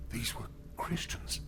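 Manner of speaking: hushed, horrified whisper. Acted. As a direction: breathe it out in dread. Corpse narrating from the pit.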